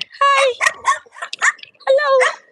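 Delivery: squeaky high hello to the dogs; high greeting to dogs. Real, not acted. Greeting the guard dogs through the fence, high and sing-song.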